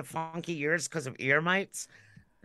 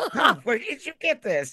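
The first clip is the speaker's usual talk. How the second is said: mock surprise; 7 semitones higher, 5 dB louder than usual. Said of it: "and I'm like".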